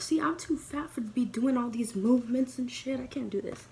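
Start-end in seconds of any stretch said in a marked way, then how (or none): none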